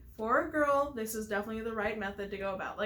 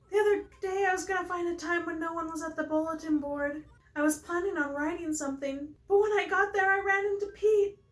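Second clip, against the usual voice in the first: put-on high, raised girlish storytelling voice; lovestruck Pelly character, anxious; 7 semitones higher, 5 dB louder than usual. Player reading Pelly's story of running into Pete, high and anxious. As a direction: play an eager, nervous character, pitched well up.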